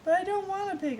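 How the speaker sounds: high, loud protest; yelling. Acted. Boy yelling at aunt that he doesn't want a pig, loud and high. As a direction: shout it, refusing the pig outright. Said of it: "I yelled".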